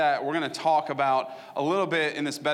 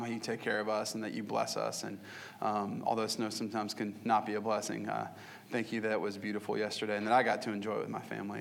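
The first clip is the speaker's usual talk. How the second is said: low, soft praying voice; prayer; 4 semitones lower, 4 dB quieter than usual. Real, not acted. Pastor praying to God for his congregation, hushed prayer. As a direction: pray quietly and sincerely, low in the voice.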